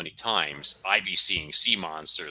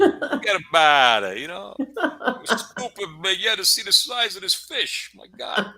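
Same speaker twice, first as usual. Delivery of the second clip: put-on loud, high, laughing Brooklyn accent; 12 semitones higher, 7 dB louder than usual. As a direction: ham up a Brooklyn tough guy. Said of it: "you gotta say it like you're from Brooklyn".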